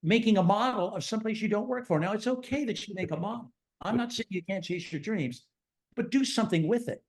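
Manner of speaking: high-pitched, insistent lecture; wifely scolding. Retold or imitated. Wife lecturing husband, retold.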